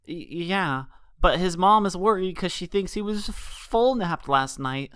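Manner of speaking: put-on high, halting scared child voice; stammering colt. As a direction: stutter through the fear.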